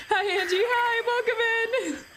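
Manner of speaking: high, drawn-out welcome through laughter; giggly greeting. Real, not acted. Streamer greeting a newcomer mid-giggle, high laughing voice. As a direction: greet them while still cracking up.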